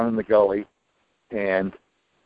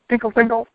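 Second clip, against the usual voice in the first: high sing-song tinkle; imitated jingling; 12 semitones higher, faster than usual. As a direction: mimic a jingling dog collar, high-pitched. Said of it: "the dog collar jingling noise".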